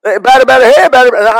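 loud, quick batter chant; infield chatter. Retold or imitated. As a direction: re-enact rhythmic baseball heckling. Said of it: "he'd make you yell".

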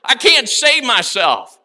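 re-enacted cry to the Lord, each phrase punched out. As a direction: preach it as an urgent, repeated confession. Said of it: "I cried unto the Lord".

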